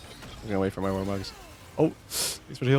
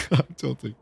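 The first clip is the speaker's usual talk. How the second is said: ordinary delivery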